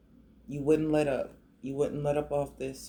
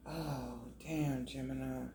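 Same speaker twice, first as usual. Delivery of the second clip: much softer, on the edge of tears; holding back tears; 13 dB quieter than usual. Real, not acted. Tarot reader moved by Gemini's cards, softly holding back tears. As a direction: fight the tears, barely voiced. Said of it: "I'm not gonna cry".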